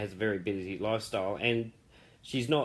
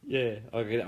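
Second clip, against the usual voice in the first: ordinary talk, nothing marked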